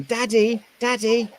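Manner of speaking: put-on high, childlike calling voice; imitating little son. Dad imitating his little son calling out to him. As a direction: pitch way up like an excited small boy.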